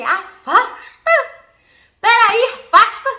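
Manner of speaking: slow, loud heroine order to stand back; heroic command. Retold or imitated. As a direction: order them back like Wonder Woman. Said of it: "Mamãe baixou a Mulher Maravilha".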